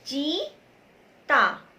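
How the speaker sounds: stretched teaching demo; slowly sounded out. Shown as a demonstration. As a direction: say चीता very slowly, each syllable stretched. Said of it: "बोल के देखिए".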